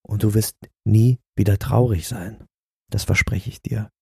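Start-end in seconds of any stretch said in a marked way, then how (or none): 2.9-3.9 breathy whispered promise; a secret whispered promise to the listener. Acted. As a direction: lean in and whisper it. Ad read, intimate promise aside.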